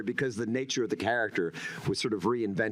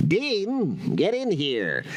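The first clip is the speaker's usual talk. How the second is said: higher, rubbery cartoon scientist; cartoony Venture; 5 semitones higher, 6 dB louder, much slower than usual. Shown as a demonstration. Actor recalls his first over-the-top take, rubbery cartoon. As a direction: bark for Dean like a wacky cartoon dad. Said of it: "cartoony, kind of rubbery".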